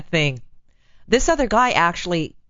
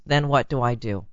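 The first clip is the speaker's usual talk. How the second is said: usual speaking voice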